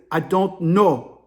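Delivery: put-on very slow African-accented reply; imitated African caller. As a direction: African accent, each word drawn out. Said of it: "The African dude's whispering".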